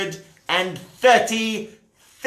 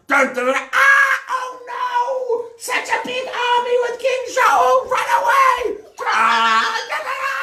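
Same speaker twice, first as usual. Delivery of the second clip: panicked shrieking. Acted. Enemy soldiers fleeing the army, high panicked shrieks. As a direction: shriek and run away.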